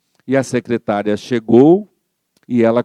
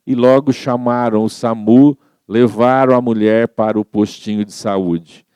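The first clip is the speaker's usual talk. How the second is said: ordinary delivery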